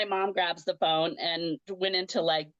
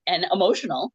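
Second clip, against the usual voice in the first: ordinary talk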